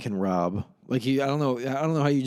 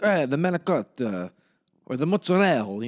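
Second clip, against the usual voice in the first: raised, punched-up mock Italian-American accent; 4 semitones higher, 4 dB louder than usual. Imitating movie characters' Italian talk, exaggerated Italian-American accent. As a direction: ham up the Italian-American food names. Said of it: "the really Italian thing".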